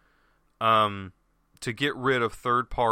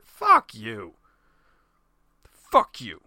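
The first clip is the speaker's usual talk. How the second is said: loud, lower-pitched angry outburst; pissed off; 5 semitones lower, 5 dB louder, much slower than usual. Real, not acted. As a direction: curse them out, loud and low. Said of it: "I am so pissed off".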